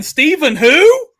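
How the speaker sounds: high, loud mock huffy exit; mock viewer quote. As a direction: play a jilted viewer storming off. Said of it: "Chad Edmonds is already saying".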